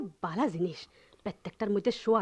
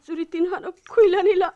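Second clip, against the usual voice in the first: loud protest; 7 semitones higher, 8 dB louder than usual. Acted. Wife protests louder.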